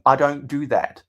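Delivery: usual speaking voice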